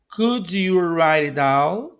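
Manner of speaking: Could you write it down, slow and drawn out; slow model. Shown as a demonstration. Teacher modelling a question for learners, slowly. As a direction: space out each word for learners.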